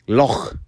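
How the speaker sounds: "loch" ending in a throaty scrape. Shown as a demonstration. Teacher correcting the American K, throaty "loch". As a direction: rasp the back of the throat to end "loch". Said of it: "really it's more of a".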